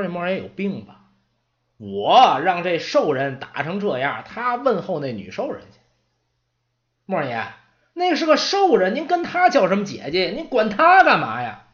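high, loud exasperated protest; can't believe what he's hearing; indignant apprentice. Acted. Apprentice protesting to the old servant about the orc, indignant.

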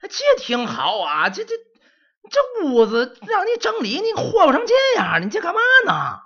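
put-on old servant blowing up at the mess; angry old man. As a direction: scold the boy furiously, voice climbing. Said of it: "莫罗斯一看就急了".

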